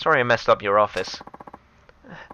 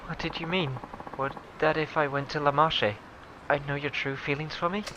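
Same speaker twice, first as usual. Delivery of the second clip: soft question; 3 semitones higher, 10 dB quieter than usual. Acted. Hushed, tender question.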